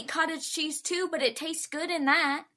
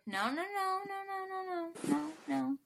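quick soft run of high "no"s; fussy denial; 6 semitones higher, 9 dB quieter than usual. Real, not acted. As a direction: brush off the guess, light and high. Rejecting the cottage cheese guess.

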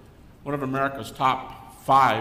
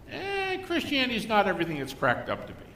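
higher dismissive tone; mock doubter; 8 semitones higher than usual. Doubter dismissing Christianity, mock shrug. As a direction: lightly shrug off the faith. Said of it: "how we always download doubt".

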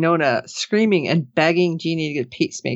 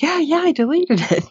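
high, sing-song sarcastic yeah-yeah; mock a liar who swears it's gone; mocking fake denial; 10 semitones higher than usual.

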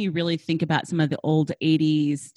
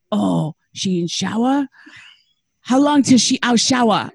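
imitated Korean-accented English, growing louder; mother's Korean accent; 3 semitones higher, 6 dB louder, slower than usual. Mother pretending to be someone else, imitated Korean accent. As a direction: probe in Mom's put-on Korean accent. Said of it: "She's got this accent".

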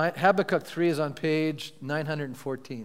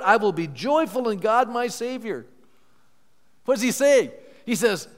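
higher, louder proclamation building to triumph; fervent scripture preaching; 5 semitones higher, 6 dB louder than usual. Acted. Pastor declaring Habakkuk's faith to the church, triumphant preaching.

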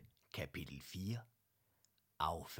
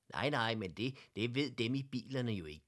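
usual speaking voice

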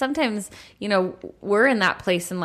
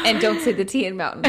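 laughing; 4 dB louder, faster than usual. Real, not acted. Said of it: "laughing joke".

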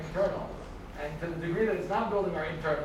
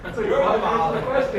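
laughing; 4 semitones higher, 9 dB louder than usual. Real, not acted. Laughing at the irony.

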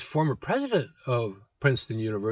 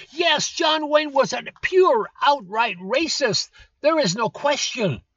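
sarcastic concession; 11 semitones higher, 5 dB louder than usual. Real, not acted.

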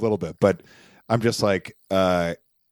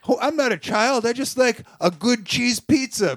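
higher, whiny mock commenter voice; mocking angry commenters; 10 semitones higher than usual. Podcast host mocks angry TikTok commenters, higher whiny voice. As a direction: sneer out the commenters' complaints, pitched up. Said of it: "And it's just people like".

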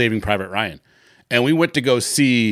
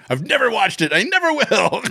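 high, playful declaration of pride; mock proud vow; 11 semitones higher than usual. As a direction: announce it like a badge of honor, higher. Mock proud vow, badge of honor.

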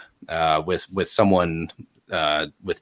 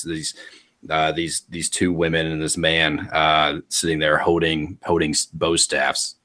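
ordinary delivery

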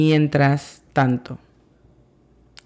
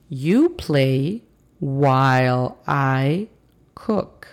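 slow, careful teacher reading; slow model sentence; much slower than usual. Shown as a demonstration. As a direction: spell it out slowly for learners. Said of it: "teacher models example sentence".

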